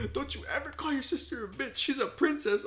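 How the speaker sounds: high-pitched imitation of a grandma; scolding grandma. Grandma scolds him, voiced in story.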